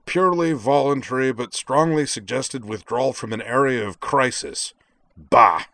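loud, scornful rant ending in a barked Bah; fed up and blustering, spitting the last word; angry. Acted. Character storms off, crumpling paper.

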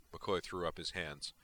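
usual speaking voice

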